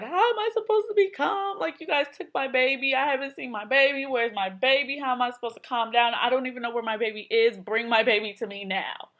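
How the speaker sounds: put-on high, loud, tearful pleading for her baby; new mother sobbing at the nurse to bring her baby; crying plea. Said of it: "I break out crying".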